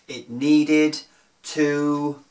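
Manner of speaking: storyteller landing the silly punchline, slow then loud; comic build-up. A narrator's reading. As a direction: hold the suspense, then blurt the punchline.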